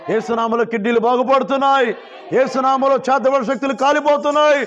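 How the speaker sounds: high proclamation building to a loud shout; full-force preaching. Real, not acted. Preacher declares healing in Jesus' name, building to shouted praise.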